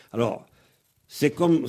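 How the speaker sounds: usual speaking voice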